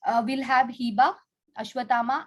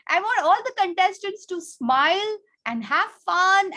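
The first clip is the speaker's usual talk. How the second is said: delighted praise; 8 semitones higher, 4 dB louder than usual. Real, not acted. Praising a child's correct answer.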